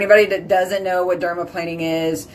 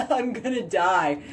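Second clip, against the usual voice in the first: laughing through disgust; giggling groan; 9 dB quieter than usual. Real, not acted. Laughing at the stench.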